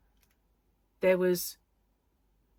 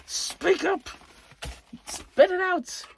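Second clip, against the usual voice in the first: higher, louder mock heckle, last bit drawn out; impatient heckling; 11 semitones higher, 4 dB louder, slower than usual. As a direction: egg them on to speak up. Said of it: "just wanting to wind 'em up a bit".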